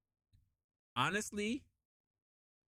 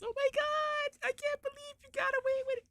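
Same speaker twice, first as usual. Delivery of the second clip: mock tearful. Team greeting a cleared colleague, mock tearful wail. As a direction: imitate a tearful coworker in falsetto. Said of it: "they were in tears".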